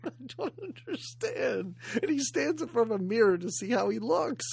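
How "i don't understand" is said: higher, softer, drawn-out mock bewilderment; perplexed. Podcaster imitating Ted Danson's bad acting, mock perplexed. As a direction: slowly imitate a baffled, sad man. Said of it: "He's totally perplexed why he's sad".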